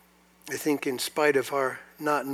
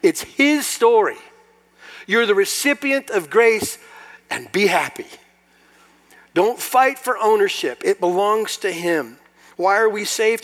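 loud, high, impassioned preaching; fervent pulpit cry; full-force preaching; 8 semitones higher, 7 dB louder than usual. Acted. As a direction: preach it out to the whole room.

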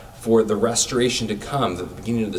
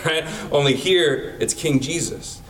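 ordinary delivery

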